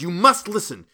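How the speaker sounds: loud, high, raving commands; furious shout. A narrator's reading. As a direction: tyrannical shouted ultimatum. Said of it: "I shouted".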